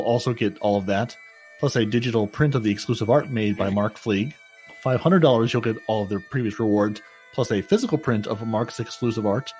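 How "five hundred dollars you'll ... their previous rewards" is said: usual speaking voice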